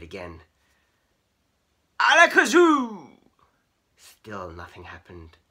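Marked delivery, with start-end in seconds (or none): 2.0-3.2 high, loud, drawn-out spell; magician's incantation. Acted. Keith waving his wand around, grand spell-casting cry. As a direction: proclaim the spell, stretching every vowel.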